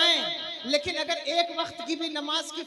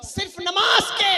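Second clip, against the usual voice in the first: emphatic preaching cry; 2 semitones higher, 6 dB louder than usual. Real, not acted.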